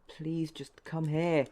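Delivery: slow, loud exasperated plea; slow pleading. Real, not acted. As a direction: drag it out, begging. Begging the game.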